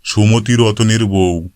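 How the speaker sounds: plain reading voice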